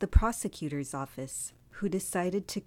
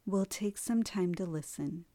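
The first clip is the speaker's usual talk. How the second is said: guided meditation. Acted. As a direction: lead them gently into stillness.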